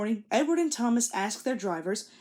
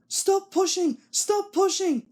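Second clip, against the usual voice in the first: higher, louder urgent plea; hissed; 10 semitones higher, 5 dB louder, slower than usual. A narrator's reading. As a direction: hiss it urgently at the trucks. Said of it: "he hissed".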